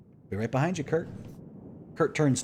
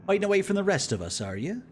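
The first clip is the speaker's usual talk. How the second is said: raised, quicker character line; 11 semitones higher, 6 dB louder than usual. Acted. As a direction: read it as a wry officer. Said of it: "A little bit lib in his voice".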